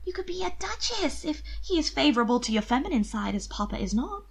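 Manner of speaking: a sister coaxing her brother gently; pleading sister. A narrator's reading. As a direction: beg him sweetly. Mina pleading.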